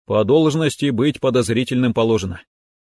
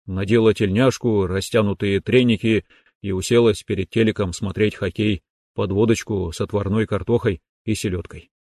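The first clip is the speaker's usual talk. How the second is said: ordinary delivery, nothing marked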